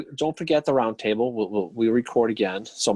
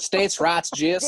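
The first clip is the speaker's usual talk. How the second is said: put-on Southern accent; 8 semitones higher, much slower than usual. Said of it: "a Canadian woman do a Southern accent".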